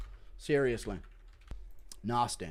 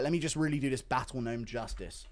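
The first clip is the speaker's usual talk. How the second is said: ordinary delivery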